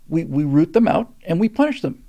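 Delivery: ordinary talk, nothing marked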